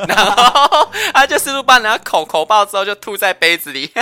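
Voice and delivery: talking through laughter; giggling words out; laughing. Real, not acted. Laughing while describing scene. (边笑边说)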